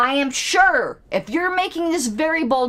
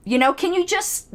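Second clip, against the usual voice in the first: normal talking voice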